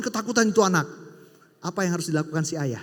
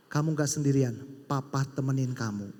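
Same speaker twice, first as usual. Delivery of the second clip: softer, lower reassurance; tender father; 6 semitones lower, 5 dB quieter than usual. Retold or imitated. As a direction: comfort the child gently. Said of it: "papanya bilang gini". (ayah menghibur anaknya dengan lembut)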